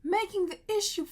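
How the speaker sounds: loud, high-pitched indignant rant; exasperated outburst. Real, not acted. Venting about the dude on his phone, loudly exasperated. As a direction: raise your voice in exasperation.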